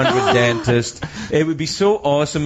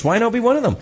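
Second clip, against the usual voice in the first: ordinary delivery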